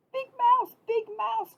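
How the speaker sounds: high, loud little mouse call. A narrator's reading. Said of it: "called Little Mouse".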